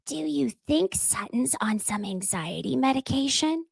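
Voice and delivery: soft, hushed gossip aside; whispering. Retold or imitated. Jennifer leaning over to Garcelle, hushed aside. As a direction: lean in and murmur it secretly. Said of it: "and whispers".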